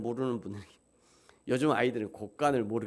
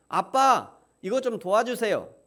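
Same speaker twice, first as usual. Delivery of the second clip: plain asking; 7 semitones higher, 3 dB louder than usual. Shown as a demonstration. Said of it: "이게 파라예요".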